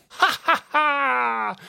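high, slow villain laugh; drawn-out, forced "ha ha ha"; bitter villainous cackle. A narrator's reading. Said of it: "Rumble said bitterly".

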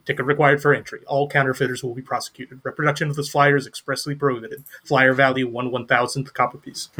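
fast fine-print rattle. Acted. Reading the flyer's fine print, fast rattle. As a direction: rush it like small print. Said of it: "in fine print, very small".

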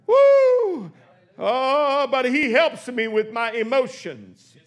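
normal talking voice

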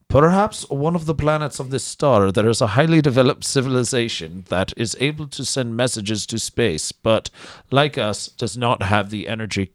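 hammed-up Russian accent. Host reading the scientist's words in a put-on Russian accent. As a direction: read it like a mock Russian scientist. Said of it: "I try to do the Russian accent".

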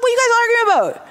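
high, fast incredulous rebuke; exasperated Jesus. Retold or imitated. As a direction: cut into the argument. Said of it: "Jesus is like".

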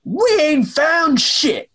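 a slow, high put-on villain voice, mock shouting; Dark Helmet line. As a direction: drag out a high, silly Dark Helmet report. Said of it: "to somehow say".

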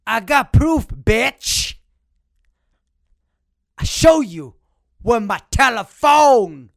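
furious rant. Acted. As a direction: bellow the insults slowly like a thug. Insulting grandma with proof. (engueulade exagérée, lente et forte)

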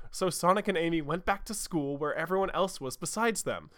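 normal talking voice

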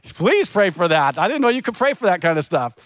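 high, laughing eager yes; delighted server. Retold or imitated. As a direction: cheer the idea on with a laugh. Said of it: "you go like".

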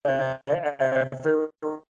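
choppy, breaking-up audio; words dropping out over bad Wi-Fi; bad connection. Real, not acted. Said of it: "You're frozen again right now".

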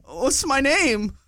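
high, slow, lost question; slow, high, bewildered; confused question. Retold or imitated. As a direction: ask it like a dazed man. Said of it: "He goes".

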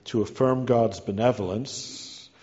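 normal talking voice

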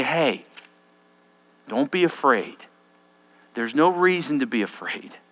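soft, low reassuring voice; calming. Acted. Angel soothes trembling Daniel like a parent, softly. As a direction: soothe a scared child.

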